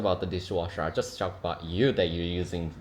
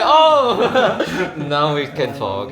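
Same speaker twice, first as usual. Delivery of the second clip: perky relief; 7 semitones higher, 11 dB louder, much slower than usual. Retold or imitated. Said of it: "once my mother came in".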